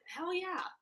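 high hyped-up cheer, retold; excited agreement. Recalling agreeing to girlfriend's photos, excited.

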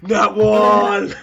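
loud, high, drawn-out cry; shouted in disbelief at the dice; loud outburst. Real, not acted. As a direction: blurt it out loudly, stretching the last word. Game reaction to bad roll.

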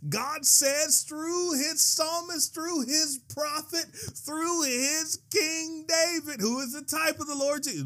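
very high, sustained preacher's cry; excited preaching. Real, not acted. Preacher answering an objection from Psalms, excitedly. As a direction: preach it in full excitement, pitch held way up. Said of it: "I'm about to get excited".

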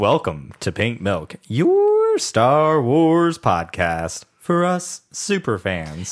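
slow drawn-out show opener; announcer intro. Acted. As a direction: stretch it like a podcast host.

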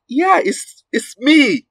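high, playful insistence; high excited reply. Retold or imitated. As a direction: squeak it out, amused. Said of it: "I'm like".

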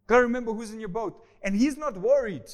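ordinary delivery

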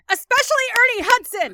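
excited outburst. Real, not acted. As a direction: shout his name like a fan who can't hold back. Enthusiastic shout about favorite actor.

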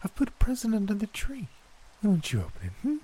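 put-on high, quick coaxing old woman; high granny voice. A narrator's reading. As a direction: push it sweetly. Gran voiced.

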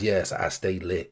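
low, slow put-on cool; dad trying youth slang; mock young slang. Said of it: "use the words that these young people use".

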